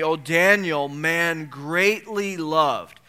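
slow, tender calling of his name; gentle, slow reading of the angel's words; tender address. Acted. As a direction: linger lovingly on each word. Angel addresses Daniel, read slowly.

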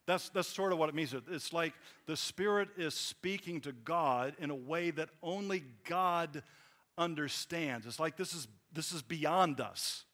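usual speaking voice

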